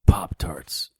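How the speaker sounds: Pop-Tarts whispered faintly; weak whisper. Acted. Injured hero whispers his request to the mother, faint. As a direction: barely breathe out Pop-Tarts.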